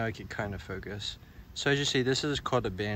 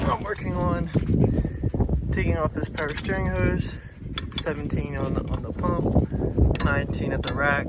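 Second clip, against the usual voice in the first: lying under a car; 5 semitones higher, 6 dB louder than usual. Real, not acted. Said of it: "I'm under the car".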